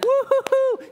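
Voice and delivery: high, loud whooping cheer; mock cheering. Friends cheering the girl's comeback to the atheist, mock high whooping. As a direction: whoop like a gleeful crowd of friends.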